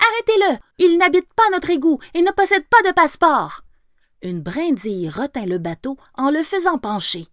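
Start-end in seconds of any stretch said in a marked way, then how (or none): 0.1-3.6 much higher, louder shout; rat shouting. A narrator's reading. Rat yells to the floating twigs, high loud shout. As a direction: yell after the boat to stop it. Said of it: "en criant aux brins de paille".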